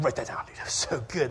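low, soft, impressed murmur; quiet aside to friend. Acted. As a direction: lean over and confide it to a buddy. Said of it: "you look to your friend".

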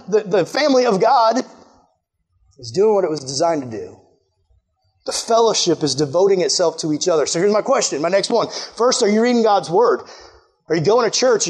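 ordinary delivery

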